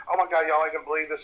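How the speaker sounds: rapid-fire retold panic; panicked rush. Telling partners what was seen, in a breathless-style rush. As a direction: blurt it out fast, imitating a panic. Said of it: "I'm talking so fast".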